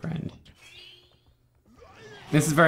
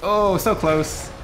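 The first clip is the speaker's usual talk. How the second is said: dismayed; 10 semitones higher, much slower than usual. Real, not acted. Near miss.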